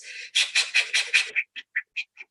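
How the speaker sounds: rapid puffs of breath; noisy mock exercise panting; exaggerated workout breathing. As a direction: imitate someone huffing through jumping jacks. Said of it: "breathing the whole time like this".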